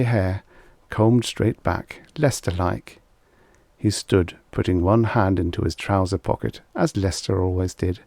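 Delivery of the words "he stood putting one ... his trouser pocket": plain reading voice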